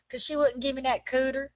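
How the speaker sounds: put-on high, loud Southern kid complaint; mock-mad child with a Southern accent. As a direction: imitate an aggrieved little Southern boy. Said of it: "just real Southern kid style".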